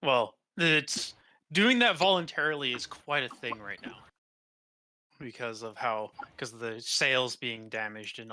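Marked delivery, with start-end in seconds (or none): none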